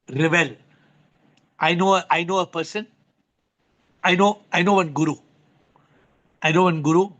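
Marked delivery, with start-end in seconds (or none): none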